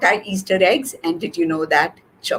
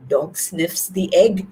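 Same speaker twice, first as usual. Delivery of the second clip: usual speaking voice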